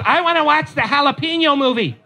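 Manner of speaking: put-on high, sweet toddler voice; lightly voiced small girl asking for a movie; little girl. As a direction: ask like an excited three-year-old. Daughter voiced by dad.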